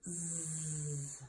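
"Zzz" as one long low buzz; letter sound demo. Shown as a demonstration. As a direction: hold "Zzz" softly, buzzing. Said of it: "Zeke Zebra says".

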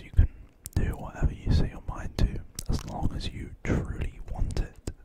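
ordinary delivery

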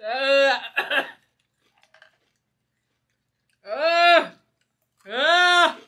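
breathless, coughing grunts; choking, can't breathe. Real, not acted. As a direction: struggle for air between coughs. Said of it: "I cannot breathe when I go".

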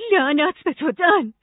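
loud, high cry of disgust; disgusted outburst. A narrator's reading. Nora curses Dan in disgust, loud and high. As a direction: fling the curse at him. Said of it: "نورا با انزجار گفت".

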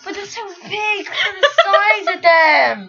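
laughing shout. Real, not acted. Marshmallow-sized Jaffa Cakes reaction.

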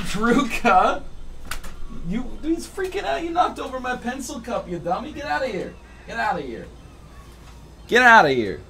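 high playful scold; scolding pet voice. Real, not acted. Streamer scolding the cat on the desk, high voice.

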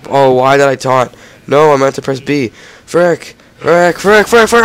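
loud, high frustrated yelling. Real, not acted. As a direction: yell in real frustration. Taunted by mistake, swearing.

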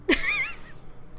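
squeaky high-pitched giggle; a tiny embarrassed giggle up high. Real, not acted. Gamer laughing at her own tears, a high giggle.

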